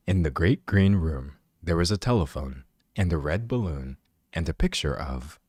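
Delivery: low, soft bedtime-story narrator; hushed, low storybook reading; audiobook voice. Shown as a demonstration. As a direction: read it like a chill audiobook narrator. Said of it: "I can literally do the audiobook voice".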